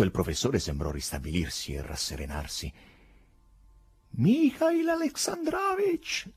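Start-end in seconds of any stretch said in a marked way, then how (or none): 4.1-6.3 high, slow shout of the name; calling out. Acted. The professor calls out after the departing Berlioz. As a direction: call after him across the park. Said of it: "gridò alle spalle di Berlioz".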